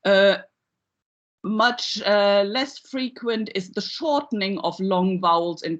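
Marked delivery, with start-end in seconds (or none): none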